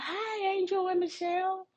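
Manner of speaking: high, fast sing-song mimicry of a coworker; mock her high sugary greeting; high mocking imitation of Christina. Mocking the coworker greeting her and Angel in a high put-on voice. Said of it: "And she was like".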